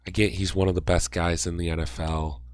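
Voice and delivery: usual speaking voice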